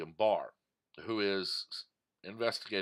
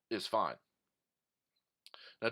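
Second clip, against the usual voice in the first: ordinary delivery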